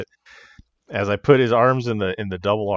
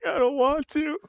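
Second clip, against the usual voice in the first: put-on very high, childish protest; mock-whiny refusal. Wrestler recounting reply to partner's dare, whiny. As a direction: play up the reluctance, half laughing. Retells reluctant answer, laughing.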